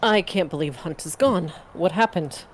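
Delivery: ordinary talk, nothing marked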